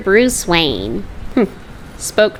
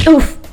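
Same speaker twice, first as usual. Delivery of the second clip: effort grunt; 9 semitones higher, 4 dB louder than usual. Acted. Struggle on the crane.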